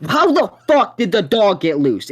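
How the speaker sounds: loud, high-pitched retold outburst; retold yell. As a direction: yell it at a friend, retelling. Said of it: "I yell at Ben".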